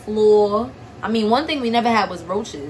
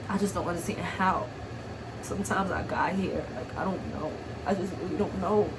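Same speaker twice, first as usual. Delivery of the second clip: tearful bewilderment; 2 semitones lower, 8 dB quieter than usual. Real, not acted. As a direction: let the voice sink softly, overwhelmed. Tearful, overwhelmed about her past.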